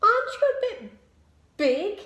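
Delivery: high questioning bee voice; lightly voiced small bee asking; Amelia the bee. A narrator's reading. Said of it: "asked Amelia".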